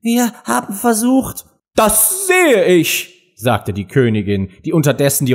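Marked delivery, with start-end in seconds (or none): 0.0-1.4 high-voiced humble excuse; submissive. A narrator's reading. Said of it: "sagte Zwei unterwürfig". 1.8-3.4 high, very drawn-out retort; imperious queen. A narrator's reading. Queen cuts the gardener off.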